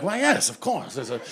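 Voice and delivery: haughty, slowly relished grandeur; smug self-satisfaction. Retold or imitated. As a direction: roll the words like you're royalty. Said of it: "find yourself agreeing".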